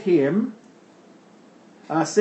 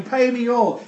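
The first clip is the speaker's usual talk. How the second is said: higher-pitched, mildly angry demand; demanding creditor; 6 semitones higher than usual. Acted. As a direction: lightly act out a creditor demanding payment. Creditor grabbing debtor by throat.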